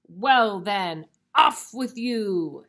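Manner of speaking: loud troll dismissal. Acted. Troll lets the little goat go, bellowing. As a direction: bellow it, drawing out the last words. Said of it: "said the troll".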